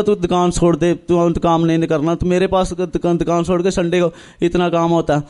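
ordinary delivery

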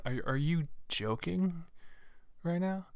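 high, slowed-down disbelief; incredulous retort. Retold or imitated. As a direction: stretch it out, openly astonished. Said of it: "I'm like".

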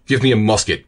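fierce barked order; savage shout. Acted. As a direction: snarl it out savagely. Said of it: "cried Sharkey with a savage oath".

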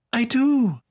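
put-on high, earnest little girl's plea; pleading child. A narrator's reading. Daughter promising her father she wants to learn, pleading. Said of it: "she had pleaded".

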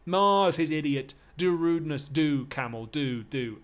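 high, loud jeering; full-voiced stage goading. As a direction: shout taunts as the blows land. Slave goads master during beating.

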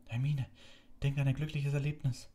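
high, urgent call to a friend. A narrator's reading. Harry calls out to Hermine in the fog, urgent and high. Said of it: "rief Harry".

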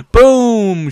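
high, loud burst; triumphant shout. Real, not acted. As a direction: shout it as the shot lands. Celebrates a kill in game.

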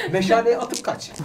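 loud, high and slow; excited. Real, not acted. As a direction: call it out excitedly. Excited plan shout.

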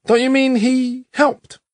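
put-on high, slow child's query. A narrator's reading. Boy pushing back at his teacher, high and slow. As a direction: play a puzzled little boy. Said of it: "I asked".

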